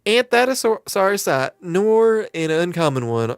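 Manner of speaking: lightly drawled southern landlady; a hint of a folksy southern innkeeper welcoming a newcomer; friendly southern twang, lightly done; southern accent. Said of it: "she went southern".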